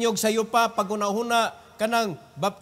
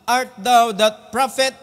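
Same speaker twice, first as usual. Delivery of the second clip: louder, drawn-out challenge; loud demanding question; 2 semitones higher, 8 dB louder than usual. Acted. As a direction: demand it of him slowly. Reading the Pharisees' question.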